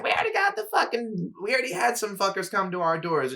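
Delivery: high-pitched, exasperated mom imitation; mom rebuffing solicitors. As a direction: mimic mom fending off a door-knocker. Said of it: "You should see my mom talk to solicitors".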